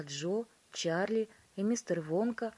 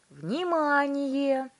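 high, loud, stretched-out cry; shouting; 8 semitones higher, 9 dB louder, much slower than usual. A narrator's reading. Wonka alerting everyone to the screen, shouting. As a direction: bellow for attention, dragging the word. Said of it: "закричал мистер Вонка".

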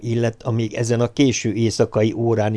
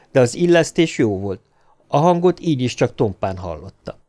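ordinary delivery, nothing marked

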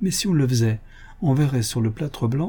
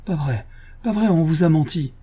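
higher, agitated protest; agitated denial; 6 semitones higher than usual. A narrator's reading. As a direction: deny it in a raised pitch. Accused man denies his identity. (dénégation agitée, voix plus aiguë)

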